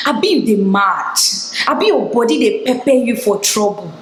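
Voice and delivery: lightly acted Nigerian Pidgin telling-off; mock Pidgin scolding. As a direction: imitate a girl scolding in Pidgin. Imitates girl scolding her in Pidgin.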